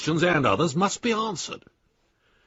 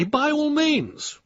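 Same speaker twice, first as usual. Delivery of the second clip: sarcastic drawl; 14 semitones higher, much slower than usual. Acted. Sarcastic reply to mad idea.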